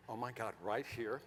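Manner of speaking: low, soft, awed visioning; gushing designer. Retold or imitated. Designer visioning the backyard with the wife, hushed gushing. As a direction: murmur it like a dreamy designer. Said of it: "talking like this and designing".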